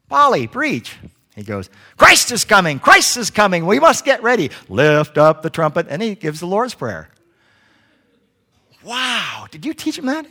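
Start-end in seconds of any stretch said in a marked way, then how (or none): 0.1-1.0 higher, louder call to the parrot; calling command. Acted. 2.0-5.9 high, loud parrot preaching; shouting parrot preacher. Acted. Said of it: "he's a preaching parrot". 8.8-10.3 high, wondering amazement; amazed. Acted. Young man amazed at parrot.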